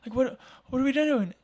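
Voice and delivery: very high, loud imitated scream of alarm; wife screaming. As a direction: shriek it like the startled wife. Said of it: "she, like, screamed".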